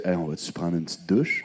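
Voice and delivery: low, soft, casual proposition; lightly voiced suitor. Man proposing a shower to his date, lightly voiced suitor.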